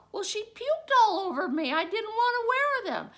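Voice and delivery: put-on high, loud kid voice; boy explaining to teacher why he undressed. Boy explains matter-of-factly.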